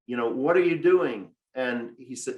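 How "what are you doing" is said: retold scream. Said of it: "my wife screamed".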